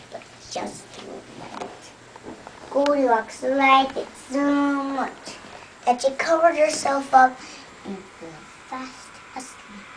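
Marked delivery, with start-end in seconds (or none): 0.1-1.9 hushed whisper of delight. Acted. Reading to a child, whispering the happy ending. Story climax, whispered payoff. 2.7-7.4 excited. Acted. Reading to a child, thrilled that the bed fits. Story read with gusto.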